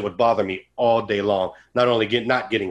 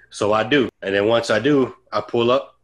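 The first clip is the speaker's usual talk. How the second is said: ordinary delivery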